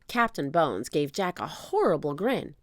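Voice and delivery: ordinary delivery, nothing marked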